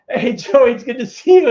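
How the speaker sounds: loud, high, gushing hello; bright, loud welcome to Joey; overly cheery greeting. Retold or imitated. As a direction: greet him like a long-lost friend. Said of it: "Josh is like".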